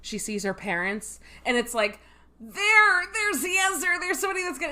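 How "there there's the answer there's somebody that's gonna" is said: high, pleading cry for help; desperate plea. Retold or imitated. Drew Barrymore's desperate plea.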